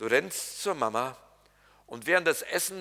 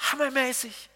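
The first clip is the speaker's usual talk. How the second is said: usual speaking voice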